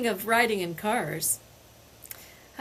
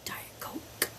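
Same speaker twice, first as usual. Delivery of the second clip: barely voiced whisper, just air; a son whispering a request from the back seat; 13 dB quieter than usual. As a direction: breathe it like a secret plea.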